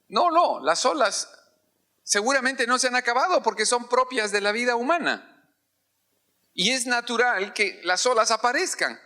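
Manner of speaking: high, urgent preaching voice; fiery preaching. Acted. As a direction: drive it home to the congregation. Preaching at rising force.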